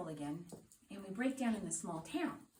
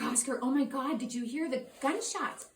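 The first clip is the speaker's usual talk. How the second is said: higher, louder burst of relief; relieved excited rush; 5 semitones higher, 6 dB louder than usual. Retold or imitated. Couple greeting friend Oscar after the scare, excited relief. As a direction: blurt it out in relief.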